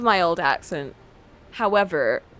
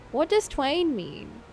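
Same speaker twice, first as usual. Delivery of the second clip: put-on Essex accent; 3 semitones higher, 8 dB quieter, much slower than usual. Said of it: "I cannot hear twain in an Essex accent".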